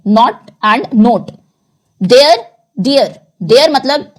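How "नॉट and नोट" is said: slow "नॉट" with open o, then "नोट" with closed o; contrasting knot and note. Shown as a demonstration. Teacher showing the class "नॉट" versus "नोट", slow. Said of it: "इनकी different sound है".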